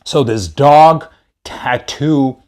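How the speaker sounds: normal talking voice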